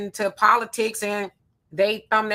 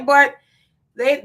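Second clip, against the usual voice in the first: usual speaking voice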